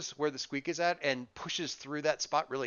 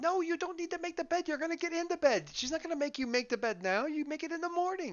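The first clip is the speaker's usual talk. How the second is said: put-on much higher small child pleading; little girl voice. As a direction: play a kindergartner begging to stay. Little sister voice, sneaking in late.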